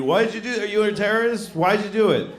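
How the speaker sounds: hammed-up official; mock interrogation. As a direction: grill the passenger. Interrogators questioning passenger.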